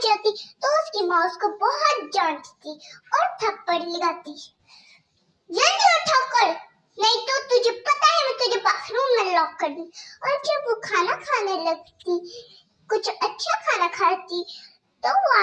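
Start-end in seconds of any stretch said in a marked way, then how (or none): 5.5-9.9 higher, louder mocking stepmother voice; harsh stepmother scolding. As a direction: bark orders at the girl like a cruel stepmother. Stepmother wakes girl.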